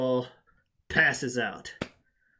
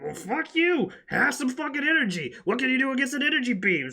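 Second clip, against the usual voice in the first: angry yell; 9 semitones higher than usual. Retold or imitated. Beerus taunting Goku, angry high yell. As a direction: snarl it out as a furious god, higher and faster at the end.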